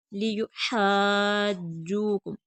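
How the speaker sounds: लियुहाज्जूकुम slow, long हा then firm doubled ज; madd on haa. Shown as a demonstration. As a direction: hold the हा of लियुहाज्जूकुम before pressing into ज्जू. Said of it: "हा पर मद होगी". (लियुहाज्जूकुम, हा पर मद)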